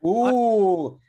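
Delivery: high, loud, long ooh; real gleeful reaction, held long; drawn-out "ooh". Real, not acted. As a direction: swoop up on a teasing "ooh". Reacts to hearing Lagos, Nigeria.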